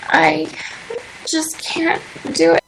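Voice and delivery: fighting back tears, barely getting it out; choked up. Real, not acted. Recounting her move to veganism to the host, choked up and tearful. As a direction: voice breaking high into a tearful near-whisper. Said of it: "it just gets so emotional".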